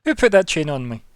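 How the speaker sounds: loud, high indignant question; outraged shout. Acted. Vampire yelling at the party about his chain, outraged. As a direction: demand it, furious.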